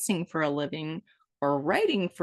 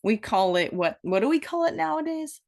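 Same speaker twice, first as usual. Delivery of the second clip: normal talking voice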